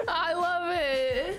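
high, slow words through laughter; laughing. Real, not acted. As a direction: say it while cracking up, delighted. Laughing at the Ditto joke.

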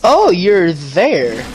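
slow, loud, glad oh; drawn-out surprise. Real, not acted. Gamer finding a teammate, slow and loud. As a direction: stretch it out, happily surprised.

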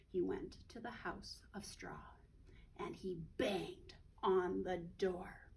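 low hushed whisper; suspenseful whisper. A narrator's reading. Narrator building suspense for children, whispering. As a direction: whisper it like a secret.

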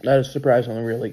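muffled behind clasped hands; speaking through hands. Real, not acted. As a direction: hands covering mouth. Seen 2: Hands pressed over mouth while speaking; chewing not visible then.

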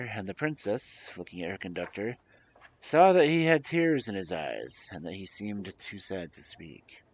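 usual speaking voice

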